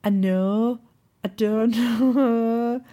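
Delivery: mock put-on voice, slowly drawn out; imitating co-host. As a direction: stretch the words in a teasing imitation. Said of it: "You do the same when you're like".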